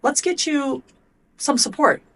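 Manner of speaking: high, slow and gentle, easing into it; a parent gently offering help to a kid; gentle offer of support. Shown as a demonstration. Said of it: "where you're like".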